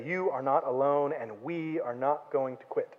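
slow, soft, sincere reassurance; reassuring promise. Retold or imitated. Church tells someone in the hole they are not alone, softly and slowly. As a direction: say it gently, slowing down to comfort.

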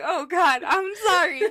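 high, loud panicked sorry; flustered apology. Retold or imitated. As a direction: blurt it out, mortified. Retold apology after slip.

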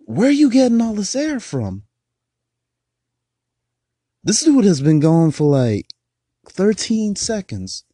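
high, loud disbelief; half-shouting in amazement; amazed. Real, not acted. Amazed at rapper's breath.

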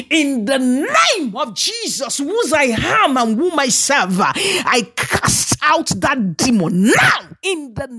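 high, forceful deliverance prayer; preach. Real, not acted. Preacher casting demons out of a listener, forceful commanding prayer.

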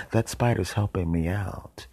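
ordinary delivery

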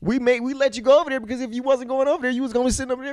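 mock exasperated dad; mocking comeback. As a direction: imitate a dad calling out his son's whining. Retells father's mocking comeback.

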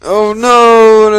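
high, loud, drawn-out mock cry of dismay; alarmed cry. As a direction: wail it out in hammed-up panic. Cries out as disaster strikes.

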